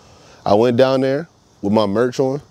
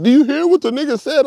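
mock caller; 3 dB louder than usual. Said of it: "motherfuckers call me like".

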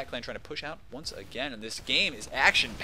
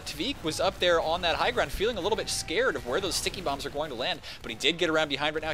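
ordinary delivery

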